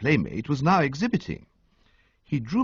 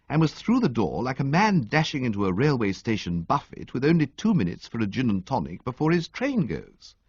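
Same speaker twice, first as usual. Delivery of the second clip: usual speaking voice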